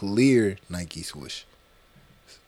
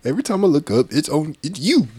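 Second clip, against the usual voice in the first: high, loud playful accusation; excited accusation; 6 semitones higher, 5 dB louder than usual. Acted. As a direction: point the finger, laughing.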